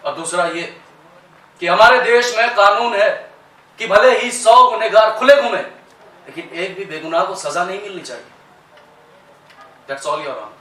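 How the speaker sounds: loud, emphatic principle, then settling down; defence lawyer. Acted. Lawyer closing the case before the judge, impassioned then composed. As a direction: drive home the closing argument, then ease off. Closing of acted courtroom speech.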